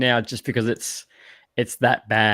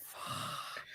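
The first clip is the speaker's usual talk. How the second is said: whispered curse, barely audible; retold stunned disbelief; 24 dB quieter than usual. Podcaster recalling his shock halfway through the film, whispered disbelief. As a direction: let the swear escape as a whisper.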